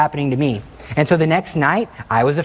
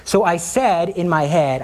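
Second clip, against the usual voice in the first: usual speaking voice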